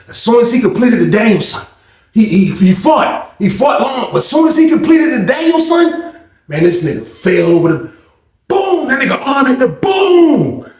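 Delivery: high, building shouted retelling; excited play-by-play. Retold or imitated. Narrator hyping up the friend's crash to the audience, high shouting. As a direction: call the fall like a sportscaster.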